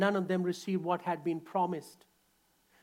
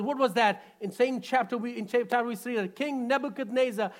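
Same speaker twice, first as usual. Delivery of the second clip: normal talking voice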